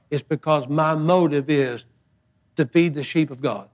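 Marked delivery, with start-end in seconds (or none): none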